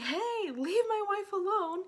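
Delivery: loud, high, drawn-out retold yell. Confronting neighbors chasing the wife, yelling. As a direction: holler up at them to back off. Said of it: "And I yelled".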